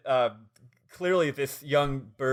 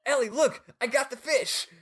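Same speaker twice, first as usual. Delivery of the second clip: high-pitched gleeful announcement; imitated excitement; 11 semitones higher than usual. He shows Ellie his catch, mock excitement. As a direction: play him thrilled, pitched way up. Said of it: "He's just so excited".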